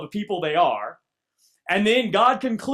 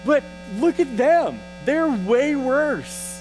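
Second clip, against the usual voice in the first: exasperated complaint; 5 semitones higher, much slower than usual. Retold or imitated. Habakkuk complaining to God.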